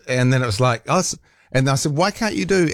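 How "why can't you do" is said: higher-pitched exasperated demand; mock-indignant challenge to the phone. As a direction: plead in annoyance, pitched up. Acted exasperated quote to phone.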